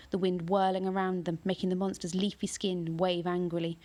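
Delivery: plain reading voice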